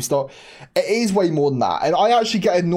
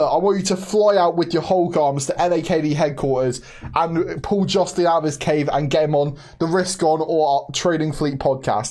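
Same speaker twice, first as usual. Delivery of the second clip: usual speaking voice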